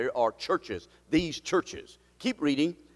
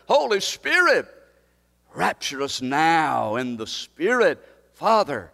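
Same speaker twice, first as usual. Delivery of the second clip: fervent prayer; 6 dB louder, slower than usual. Real, not acted. Pastor crying out in prayer for his church, fervent. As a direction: pray out with passion.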